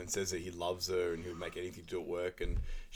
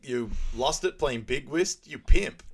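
mocking taunt; 5 semitones higher, 8 dB louder, slower than usual. [name] mocking his friend.

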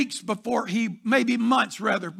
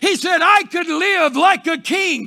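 loud, higher climactic preaching; preaching at full force; 3 semitones higher, 5 dB louder than usual. Real, not acted. Pastor rallying the congregation, climactic full-force preaching. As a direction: build the refrain to a roar.

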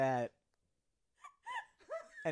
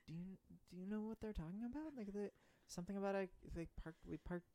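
high, quiet, fast; 6 semitones higher, 10 dB quieter, much faster than usual. Acted. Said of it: "Mina runs up next to him and is like".

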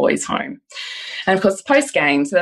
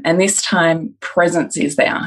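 normal talking voice

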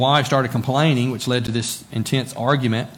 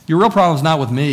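louder, faster and higher; forceful preaching; emphatic; 5 semitones higher, 7 dB louder, faster than usual. Real, not acted. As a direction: punch it home to the congregation. Preacher driving point home.

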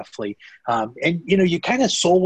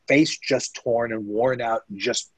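normal talking voice

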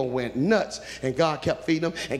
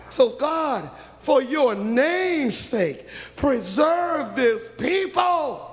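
pleading Moses; 11 semitones higher than usual. Acted. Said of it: "And Moses said".

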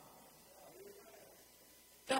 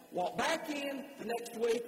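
normal talking voice

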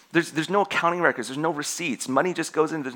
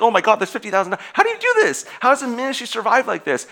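incredulous, pitch leaping much higher; mock disbelief; 8 semitones higher, 4 dB louder than usual. Preacher retelling his shock at the ministry, incredulous. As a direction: squeak out the disbelief. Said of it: "I'm like".

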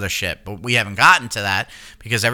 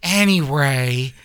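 slow, drawn-out anyway in a sultry diva voice; Coolidge impression. Shown as a demonstration. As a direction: stretch it lazily like the diva. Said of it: "say anyway".

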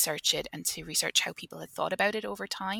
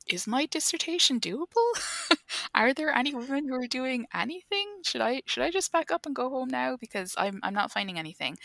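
anxious inner voice; 8 semitones higher than usual. Retold or imitated.

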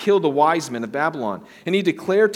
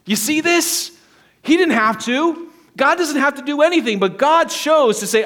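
loud, high, emphatic sermon delivery; forceful pulpit proclamation; impassioned preaching; 7 semitones higher, 4 dB louder than usual. Real, not acted. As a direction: preach it at full force, raised voice. Preacher rises to full force.

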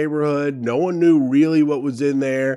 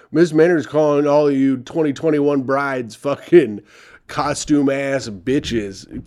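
ordinary talk, nothing marked

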